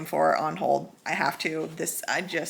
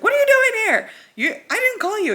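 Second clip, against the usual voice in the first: much higher, louder flustered squeal; startled squeal; 8 dB louder than usual. Retold or imitated. Startled boy finding the hero waiting at the door, squealing. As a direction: caught off guard and flailing.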